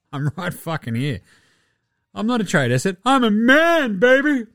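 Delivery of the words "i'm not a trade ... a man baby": higher and louder, building to an outraged cry; indignant cry. Retold or imitated. Danny Green protesting to Windhorst, a building indignant cry. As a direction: protest that you're a man, rising hard.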